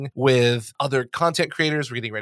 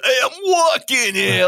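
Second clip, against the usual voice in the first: a hint of a loud, higher New York accent; 10 semitones higher, 4 dB louder than usual. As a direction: call it out like a New York cabbie. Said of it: "doing the New York accent".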